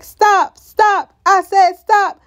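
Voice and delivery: high, loud mock command; shouted order. Boyd pointing his weapon at Elgin, mock shouted order. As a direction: imitate an armed man ordering a halt.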